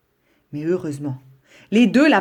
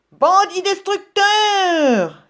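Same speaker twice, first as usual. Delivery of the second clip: much higher, slow drawn-out shouted insult; yelled insult; 13 semitones higher, 4 dB louder, much slower than usual. Acted. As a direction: hurl the insult across the garden. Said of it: "hurlait Monsieur Brun".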